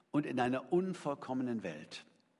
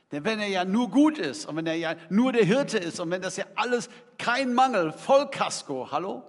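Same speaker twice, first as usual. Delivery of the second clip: louder, higher mock enthusiasm; 5 semitones higher, 8 dB louder than usual. Preacher mocking prosperity gospel, loud and higher. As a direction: parody the all-good prosperity preaching.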